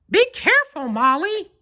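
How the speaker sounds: very high falsetto warning; frowning Mickey. A narrator's reading. Mickey warning Molly about the toy, high and stern. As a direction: warn the cat like Mickey. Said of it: "he said with a frown".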